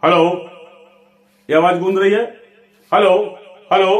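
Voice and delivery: short calls of hello, as if bouncing off a wall; echo demo. Shown as a demonstration. Teacher demonstrating echo to the class, calling out. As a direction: call hello out as an echo would. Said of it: "जैसे मैं आपको दिखाता हूं".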